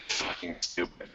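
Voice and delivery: sighed, whispered grumble; exasperated whisper. Acted. Guard and player discuss the dumb plan, whispering wearily. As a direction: breathe it out under a heavy sigh. Muttered sigh about the plan.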